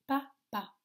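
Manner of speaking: PAPA as pa, pause, pa; split into syllables. Shown as a demonstration. Teacher gives children a word, PAPA split in two syllables. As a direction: break PAPA into two separate beats. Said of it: "dans les deux syllabes".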